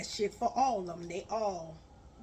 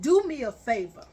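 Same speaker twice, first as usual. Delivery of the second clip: louder and faster, scolding; angry rant; 8 dB louder than usual. Retold or imitated. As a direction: tear into him, raising your voice. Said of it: "I said".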